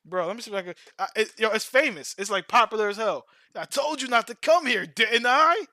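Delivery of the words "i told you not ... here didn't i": put-on anime kid voice. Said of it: "He don't talk like that".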